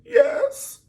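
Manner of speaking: high, loud mock yes; mock shrill yes. Rioter woman answering the news reporter, high loud mock yes. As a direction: squeal it like the teary rioter.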